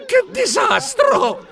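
very high, drawn-out cry of despair; desperate wail. Acted. Director after being laughed at, shrill despair. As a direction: wail helplessly at the top of your voice.

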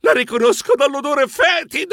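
high cry of disgusted fright; horrified exclamation. A narrator's reading. Narrator recognises the mummy's stench, horrified.

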